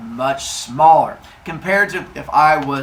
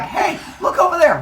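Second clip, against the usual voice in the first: much higher, laughing trick voice; sneaky brother; 14 semitones higher than usual. Retold or imitated. Brother tricking brother, high and laughing. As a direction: lure him to look away, grinning. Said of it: "they're like".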